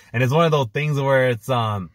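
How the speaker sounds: ordinary delivery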